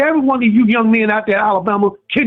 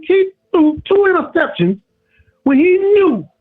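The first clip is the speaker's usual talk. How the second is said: impassioned rant; 6 semitones higher than usual. Real, not acted. Caller's impassioned rant about the quarterback.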